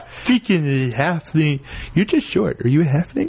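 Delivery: grumbling ranger. Acted. Same ranger NPC insulting player.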